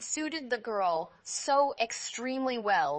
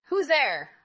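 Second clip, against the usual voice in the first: louder gruff wolf; wolf in the bed answering the girl, louder; big wolf voice; 6 semitones higher, 6 dB louder than usual. Acted. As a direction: play the wolf pretending. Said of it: "big voice of the wolf".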